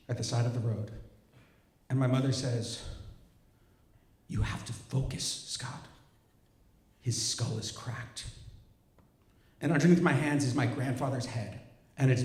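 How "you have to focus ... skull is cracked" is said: breathy whisper, low and slowing at the end; hushed urgent whisper at the roadside. Retold or imitated. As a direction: whisper the order over an injured man. Mother urges at crash site.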